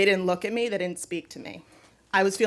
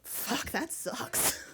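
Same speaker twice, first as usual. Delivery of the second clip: slow, breathy, deadpan and softer; simply said; 3 semitones higher, 8 dB quieter, much slower than usual. Retold or imitated. New friend answers a confession of despair, deadpan and slow. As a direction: drop it flatly under your breath, slowly. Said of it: "very simply said".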